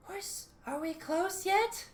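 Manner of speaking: high, slow voice inside your head. Acted. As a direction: stretch it out high and thin. Voice heard in head.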